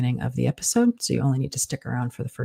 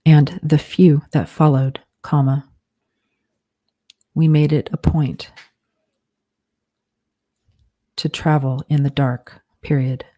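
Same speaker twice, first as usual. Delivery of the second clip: slow dictation, punctuation spoken aloud; dictating; slower than usual. Shown as a demonstration. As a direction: dictate it word by word with pauses.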